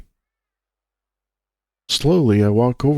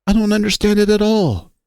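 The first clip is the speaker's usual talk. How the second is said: plain reading voice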